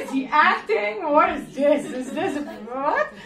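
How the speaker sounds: high, loud baffled questions; can't believe what she's hearing; incredulous. Retold or imitated. Puzzled by the swami's answer, high disbelief.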